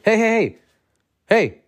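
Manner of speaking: hammed-up cop shouting hey; mock cop calling out. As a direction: imitate the cop hailing him.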